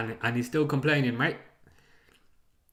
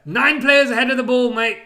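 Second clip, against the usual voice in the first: loud Aussie coach bark; loud mocking Aussie manager chant; 8 semitones higher, 9 dB louder than usual. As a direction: shout it like a cocky Aussie manager. Mocking Ange's catchphrase.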